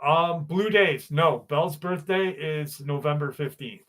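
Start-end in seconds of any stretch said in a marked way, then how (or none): none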